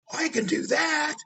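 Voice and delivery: high, slow, eager yell; eager shout. Retold or imitated. Prostitute eagerly agreeing to the fake shoot, shouting high. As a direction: shout it like she can't wait.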